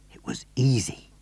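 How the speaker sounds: breathy narrator's whisper. A narrator's reading. Narrator confiding to listeners, whispered. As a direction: let it slip out as a secret.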